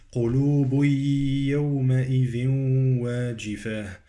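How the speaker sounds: "قلوب" melts into a long nasal y of "يومئذ"; idgham of tanwin. Shown as a demonstration. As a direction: recite "قلوب يومئذ" joined with humming glides. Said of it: "قلوب عندي تنوين يدغم في الياء".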